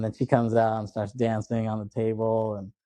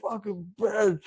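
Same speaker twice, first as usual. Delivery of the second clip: high-pitched, hammed-up last words; mock dying curse from the floor; imitated dying man; 9 semitones higher, slower than usual. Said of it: "like, really dying".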